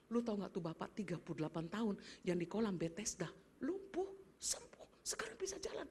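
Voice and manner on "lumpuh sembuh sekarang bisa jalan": slow, breathless whisper of amazement; awed whisper. Retold or imitated. Townsfolk whispering about the lame man healed. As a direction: whisper it as if it's too wonderful to say aloud.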